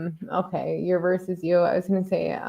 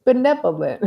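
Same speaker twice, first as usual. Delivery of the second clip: benevolent said louder and higher through a laugh; mock the rising benevolent while cracking up; laughing send-up of the rapper's benevolent; laughing mockery of the rising end; 5 semitones higher, 5 dB louder than usual. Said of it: "It almost makes it a little bit even more, like".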